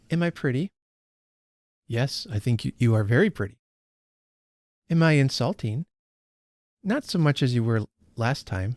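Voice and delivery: reads the passage aloud, lightly voicing Estella; unhurried storytelling read; story reading. A narrator's reading. Host reading Dickens to her book club, story narration.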